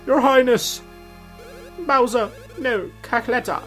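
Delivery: put-on high quavering old servant; old man voice. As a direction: voice a fussy elderly steward. Voicing Toadsworth reading dialogue.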